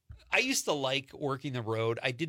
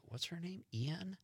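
mock puzzled question, quiet; 5 semitones higher, 12 dB quieter, slower than usual. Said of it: "he goes".

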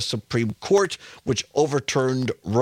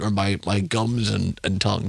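ordinary talk

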